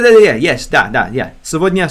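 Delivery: normal talking voice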